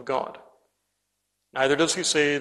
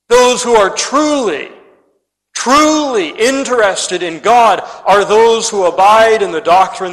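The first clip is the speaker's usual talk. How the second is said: louder, higher fiery preaching; preaching at full force; 5 semitones higher, 9 dB louder than usual. Acted. Preacher warning his congregation that doctrine matters, full-force preaching. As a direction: rise up and hammer the point home from the pulpit.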